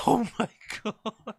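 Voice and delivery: high, laughing gasp of shock; real laughter breaking up the words; laughing disbelief. Real, not acted. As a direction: stretch it out through laughter. Cracking up at what he read.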